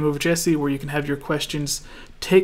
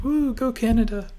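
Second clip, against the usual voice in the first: cheering fan; 8 semitones higher than usual. Retold or imitated. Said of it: "people in the chat being like".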